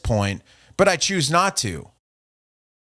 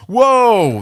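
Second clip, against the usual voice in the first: whoa; 7 semitones higher, 8 dB louder than usual. Real, not acted. Reacting to troll's threat.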